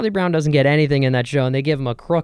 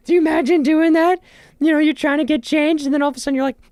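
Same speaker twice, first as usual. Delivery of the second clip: much higher, louder disbelief; high excited voice; 5 dB louder, faster than usual. Real, not acted. To listeners, picturing the changing room, squealing. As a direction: squeal it, appalled. Disgusted thrift store hypothetical.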